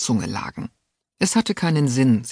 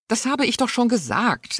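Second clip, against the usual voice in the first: higher, curt and impatient; 9 semitones higher than usual. A narrator's reading. Duchess snapping at Alida's question, impatient. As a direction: brush off the question. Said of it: "erwiderte die Herzogin ungeduldig".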